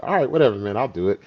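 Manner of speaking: starts high and loud, then settles; mock grudging agreement. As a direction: give in to a pushy buddy. Retold giving in to friend.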